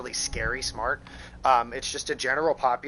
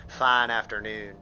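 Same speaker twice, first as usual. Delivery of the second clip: deep southern twang; 4 semitones lower than usual. Shown as a demonstration. Streamer showing the accent he doesn't have, hammed-up Southern twang. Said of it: "that deep southern twang".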